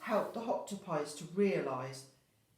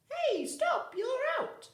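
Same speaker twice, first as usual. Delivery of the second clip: high, loud playful game call; cowboy calling out; 7 dB louder than usual. Acted. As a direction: call the kids out like a cheery referee. Cowboy runs party games.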